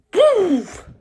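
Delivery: a sudden high, loud "poof!"; sharp mock popping noise; poof sound effect. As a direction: pop the word out like a bursting bubble. Sudden pop in his mouth.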